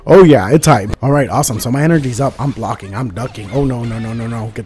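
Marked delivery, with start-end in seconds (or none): 0.1-0.9 loud, high burst of hype; hyped up. Real, not acted. Streamer landing a fatal blow, loudly hyped. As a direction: explode with excitement, loud and high.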